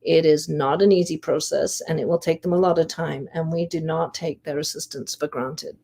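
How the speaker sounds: ordinary talk, nothing marked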